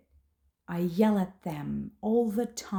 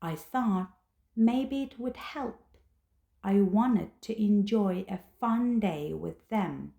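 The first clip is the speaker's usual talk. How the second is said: usual speaking voice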